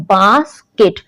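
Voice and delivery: "बास्केट" split as bas, short gap, ket; broken into syllables. Shown as a demonstration. Teacher demonstrating a word break to students, "बास्केट" in two split syllables. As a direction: say "बास्केट" in two separate beats. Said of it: "जहां पर word टूट रहा है".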